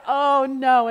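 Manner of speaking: loud, high mock-dismayed "oh no"; dismayed reaction. Mom retelling her reaction to friends' remark, loud mock dismay. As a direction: cry out in exaggerated dismay.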